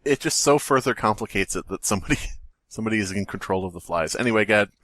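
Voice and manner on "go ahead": normal talking voice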